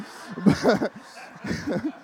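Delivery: helpless amused laugh; real laugh. Real, not acted. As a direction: burst of laughter at oneself. Cracks up at own accent.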